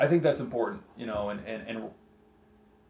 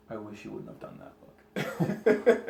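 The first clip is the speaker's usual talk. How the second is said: mock gentle disappointment; 5 semitones lower, much slower than usual. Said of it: "not disapproving look".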